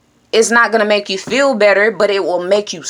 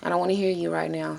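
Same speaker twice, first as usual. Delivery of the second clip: softer and quick, a gentle scold; quietly telling off; 2 semitones lower, 10 dB quieter, faster than usual. Shown as a demonstration. Vlogger quietly scolds his own thoughts. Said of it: "I often tell myself".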